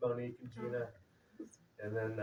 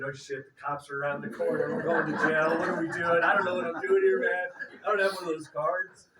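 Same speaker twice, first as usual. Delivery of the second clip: mock panic; 5 semitones higher, 8 dB louder than usual. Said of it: "like, thinking".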